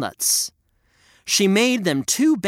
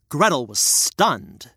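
plain reading voice

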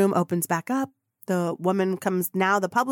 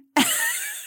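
high laugh. Real, not acted. Reaction to the intern reveal.

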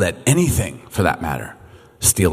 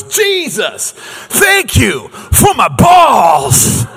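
high, drawn-out joyful shout; imitated child's scream; 9 semitones higher, 3 dB louder, much slower than usual. Five-year-old thanking Jesus in a restaurant, screaming it out. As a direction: holler it slowly like an excited kid. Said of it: "he screams at the top of his voice".

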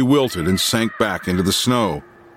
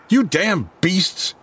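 slow, higher angry burst; exploding; 9 semitones higher, slower than usual. A narrator's reading. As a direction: finally lose it at the crowd. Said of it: "he finally exploded".

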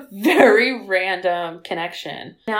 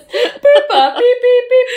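high, loud beeping dial-up imitation; mock dial-up modem beeps; dial-up noise; 13 semitones higher, 8 dB louder, slower than usual. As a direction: squeal out the modem tones. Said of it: "the bleep?".

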